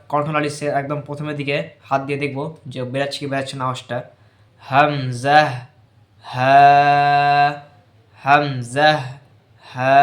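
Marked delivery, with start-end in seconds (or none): 4.6-10.0 Arabic hamza and ha, slow practice; Arabic throat letter demo. Shown as a demonstration. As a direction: sound হামজা from the start of the throat. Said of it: "এইভাবে বারে বারে practice করব".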